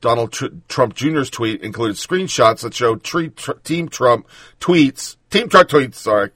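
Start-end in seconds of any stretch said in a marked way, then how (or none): none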